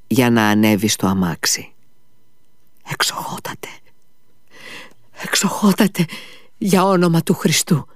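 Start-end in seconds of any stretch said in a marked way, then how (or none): 2.9-7.9 whispered, then a high frightened plea; father stopping the doctor, frightened plea. A narrator's reading. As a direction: stop him in terror, whispering first. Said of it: "τον σταμάτησε τρομαγμένος".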